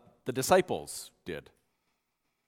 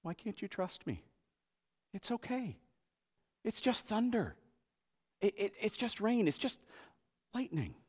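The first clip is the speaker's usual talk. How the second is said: much softer, gentle and reassuring; soothing a small child; 5 semitones higher, 8 dB quieter than usual. Acted. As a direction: comfort a scared five-year-old. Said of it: "talking to their five-year-old selves".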